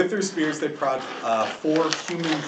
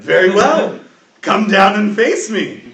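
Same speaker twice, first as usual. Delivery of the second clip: very loud, higher, slow eager call; excited challenge; 7 semitones higher, 13 dB louder than usual. Acted. Half-dragon calling the champion down, loud excited challenge. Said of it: "Oh, he's excited".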